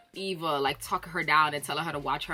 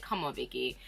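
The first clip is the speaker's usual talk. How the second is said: "Come on, Vicky" low and much softer, let down; disappointed plea; 6 semitones lower, 9 dB quieter than usual. Retold or imitated. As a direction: sigh it out at a dull friend. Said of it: "I'm like".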